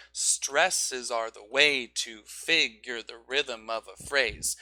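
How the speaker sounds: slow, dotting each stressed beat on "way" and "rhythm"; dotted stress rhythm. Shown as a demonstration. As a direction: space out the beats. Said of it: "dot them out".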